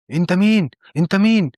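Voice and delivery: panicked demand to know who's there, lightly acted; acted alarm, voice jumping up; frightened challenge. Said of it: "وجسمه عمال يترعش".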